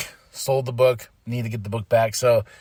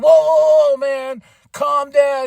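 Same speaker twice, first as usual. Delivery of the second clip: mock calming; 9 semitones higher, 4 dB louder, slower than usual. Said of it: "and they're like".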